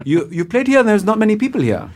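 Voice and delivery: high-pitched, courteous German interviewer; polite German accent. Retold or imitated. German interviewer pointing out poor turnout, politely German-accented. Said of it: "in a very polite tone".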